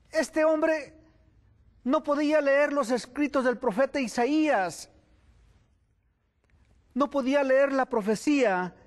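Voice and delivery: high, impassioned preaching. Acted. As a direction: press the question on the congregation. Preacher builds to full force. (predicación apasionada, voz más alta)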